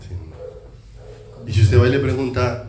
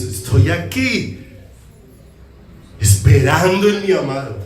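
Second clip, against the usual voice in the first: much higher, rapturous swooning voice; higher, slow and dreamy ecstatic praise; ecstatic believer; 11 semitones higher than usual. Retold or imitated. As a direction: sing it out like someone in love with God. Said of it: "estarías extasiado diciendo".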